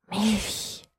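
put-on a fading child's breath of a word; weak breathy voice. Newborn boy breathing out his hunger faintly. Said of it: "hauchte die Stimme schwach".

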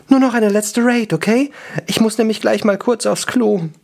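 put-on high-pitched elf girl; high elf voice, in a hurry; impatient elf. Elf figure speaks in the story.

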